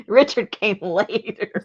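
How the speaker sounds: slow words through a laugh; amused slow aside. Real, not acted. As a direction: say it while still laughing. Laughing aside about son.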